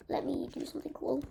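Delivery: hushed whisper to himself. Real, not acted. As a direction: whisper it under breath. Whispered aside while playing.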